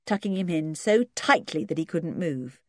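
ordinary delivery

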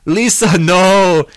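high, loud cry of horror; anguished, full-voiced shout. A narrator's reading. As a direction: shout her name in anguish. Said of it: "Johnny shouted".

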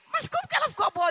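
high-pitched retold astonishment, rising in volume; amazed mother. Mother asks preacher how daughter got well, acted amazement. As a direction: voice the mother's disbelief, high and loud.

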